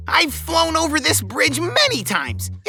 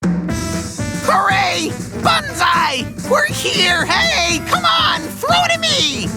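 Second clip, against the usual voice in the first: noisy taunting cheer; slower than usual. Acted. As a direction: whoop and holler to draw attention. Calling bats to throw rings.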